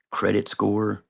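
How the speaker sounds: usual speaking voice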